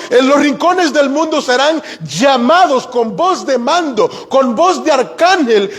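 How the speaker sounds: high-pitched, impassioned sermon climax; fervent preaching. Acted. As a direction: preach it as a rising, sweeping summons to all believers. Predicador proclama el llamado con fervor. (prédica ferviente, con tono alto y enfático)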